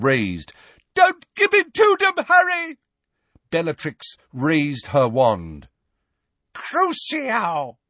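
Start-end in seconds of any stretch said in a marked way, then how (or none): 0.9-2.8 very high, loud desperate roar. A narrator's reading. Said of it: "roared Neville". 6.5-7.8 high, vicious spell; cursing witch. A narrator's reading. Bellatrix casting curse.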